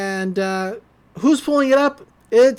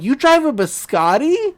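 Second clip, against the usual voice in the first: high, rising impressed question; imitated starstruck surprise; mock amazement; 6 semitones higher, 4 dB louder than usual. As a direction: ask it in playful wonder. Smitten Mabel reacts, retold.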